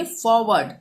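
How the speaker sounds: "फॉरवर्ड" said "faw-wud", both r silent; British pronunciation. Shown as a demonstration. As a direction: drop each r in "फॉरवर्ड". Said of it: "इसको हम पढ़ेंगे". ("फॉरवर्ड" को "फॉवड" बोलें)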